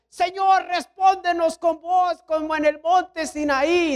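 high, slow sustained call to heaven; mock shouting. Reckless climber asking God to answer, mock shouting. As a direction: imitate a crazy lad hollering to God. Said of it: "empezó a gritar".

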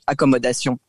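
accommodation said fully French, akɔmodasjɔ̃ with a nasal ending; Franglish word. Shown as a demonstration. Explaining Franglish to the host, accommodation said in French. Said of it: "if we do Franglish".

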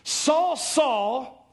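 voice of Jesus. Acted. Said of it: "he heard a voice say to him".

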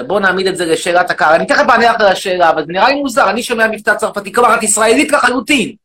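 shouting, indignant rant at the audience; loud mock rant. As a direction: bellow it like an outraged demagogue. Loud persona.

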